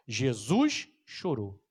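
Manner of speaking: "Jesus chorou" said very slowly, each word weighted; slow emphasis. Shown as a demonstration. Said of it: "duas palavras". ("Jesus chorou" dito bem devagar, com peso)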